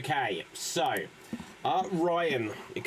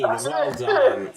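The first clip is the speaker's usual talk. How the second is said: loud, high well done; excited praise; 10 semitones higher, 9 dB louder than usual. Real, not acted. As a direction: cheer them on.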